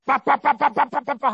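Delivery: sharp repeated pa blasts like a trumpet; staccato trumpet sound. Shown as a demonstration. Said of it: "스타카토로".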